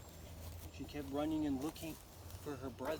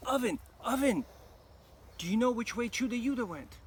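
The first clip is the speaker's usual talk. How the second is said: put-on urgent girl; 8 semitones higher, 7 dB louder than usual. A narrator's reading. Girl pleads with oven.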